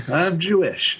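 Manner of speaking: high, drawn-out playful restatement; playful correction. Pretend. Guest rewording for the teasing host, playful correction. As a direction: stretch it out high after a laugh. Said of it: "You don't have to say it like that".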